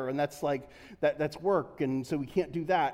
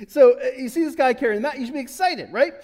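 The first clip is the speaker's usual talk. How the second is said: louder, laughing through the words; 6 semitones higher, 6 dB louder than usual. Real, not acted. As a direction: keep giggling while you make the point. Preacher laughing at own joke.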